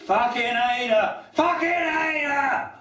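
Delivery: high, drawn-out chanted shout; climactic shouted refrain. Acted. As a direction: build to a high yelled final refrain, stretching the last one.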